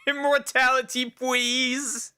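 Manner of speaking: high, pleading little voice; squeaky begging voice. Pretend. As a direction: beg for it in a tiny high voice. Begging the ancients in a silly voice.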